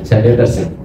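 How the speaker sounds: ordinary talk, nothing marked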